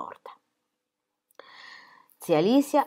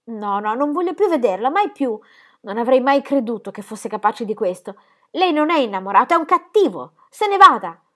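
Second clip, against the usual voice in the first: acuta e forte, sempre più gridato; rifiuto disperato; 7 semitones higher, 10 dB louder than usual. A narrator's reading. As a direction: cacciarlo via con tutto il fiato. Gigi respinge Gaston piangendo. (Gigi che caccia Gaston, sempre più gridato)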